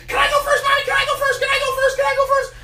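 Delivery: high, pleading chick voice over and over; a kid tugging at mom's sleeve; begging baby eagle. Acted. Eaglet begs Mommy to go first, high pleading.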